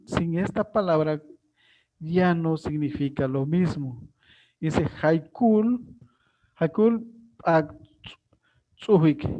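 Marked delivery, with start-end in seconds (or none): 4.6-9.2 Haikul said slowly, clearly; Maya word demo. Shown as a demonstration. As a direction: model Haikul syllable by syllable. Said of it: "¿Cuántas plantas de tomate se murieron?".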